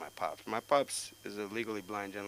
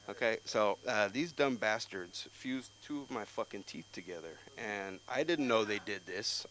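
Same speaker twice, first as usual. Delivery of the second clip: ordinary delivery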